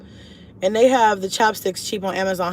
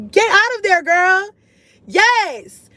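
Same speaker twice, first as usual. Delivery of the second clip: much higher and louder, teasing a viewer; playful high exclamation; 11 semitones higher, 6 dB louder, much slower than usual. Real, not acted. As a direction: squeal it like you've been caught out. Playful reply to viewer comment.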